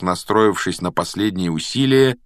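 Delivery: plain reading voice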